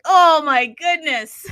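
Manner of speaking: loud, drawn-out mock-shocked exclamation; exaggerated "oh my goodness" reaction, swooping high; retold dismay. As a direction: recount your own shock, high and stretched out. Retold reaction to token dump.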